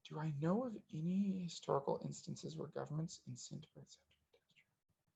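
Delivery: very soft reread, fading to a whisper; muttering to himself. Real, not acted. Host pondering a viewer's question, muttering it back to himself. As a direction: think aloud under your breath, trailing into near silence.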